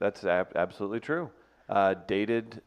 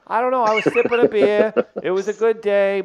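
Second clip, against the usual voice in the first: put-on higher and louder Boston sports fan accent; 10 semitones higher, 8 dB louder than usual. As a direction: rant like a Boston fan at the bar. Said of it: "you do your Boston accent".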